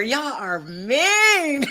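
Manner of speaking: drawn-out, loud, laughing reproach; amused scolding. Real, not acted. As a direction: scold them while cracking up. Amused by the chat's nasty nickname.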